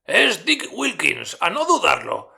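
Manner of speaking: mucho más agudo y fuerte, ilusionado; Scrooge emocionado. A narrator's reading. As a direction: reconoce su pasado con entusiasmo. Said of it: "dijo Scrooge al espíritu". (Scrooge ante el almacén, agudo y emocionado)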